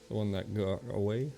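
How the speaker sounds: ordinary delivery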